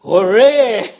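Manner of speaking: loud mock cheer of a happy crowd. Union workers cheering their rep's news, loud cheer. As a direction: shout it like a whole crowd celebrating. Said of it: "What do you think the crowd said".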